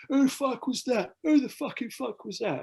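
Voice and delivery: high, slow, outraged mock yell; high angry mock voice. Mocking the dazed moshpit guy, high outraged yell. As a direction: imitate the dazed guy's squeaky fury. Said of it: "looking at it, goes".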